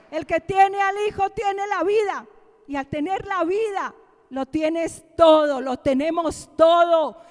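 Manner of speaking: loud, high, impassioned preaching; predicación a plena voz. Real, not acted. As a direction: proclaim it to the congregation with stretched, forceful key words. Preaches at full force. (prédica enérgica a viva voz)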